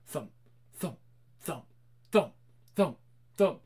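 thump it out like a heartbeat. Acted. Narrator voices the beating heart under floor.

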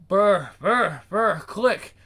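vocal phone ring and click; phone ring imitation.

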